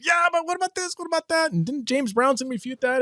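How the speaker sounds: high, far exasperated objector voice; whiny pushback voice; objecting listener. Retold or imitated. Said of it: "ripping your hair out saying".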